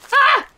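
high, loud yelp; real startled shriek; freaked-out yelp. Real, not acted. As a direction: yelp in sudden fright. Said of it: "I'm freaking out".

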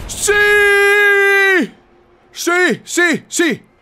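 high, loud, exultant shouts; real winner's whooping yells; victory yell. Real, not acted. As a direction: scream it out in bursts of triumph. Shouting after winning the match.

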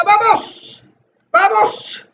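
high, punchy repeated cheer; shouted rallying cry. Real, not acted. Gamer urging himself through the level, shouted rallying cry. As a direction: shout yourself on, pitched high. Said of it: "Voy a seguir gritando "vamos".

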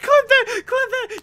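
high loud laughing call; excited shout. Real, not acted.

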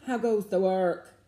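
loud farmer's call; the farmer demanding news. A narrator's reading. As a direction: bellow it from bed.

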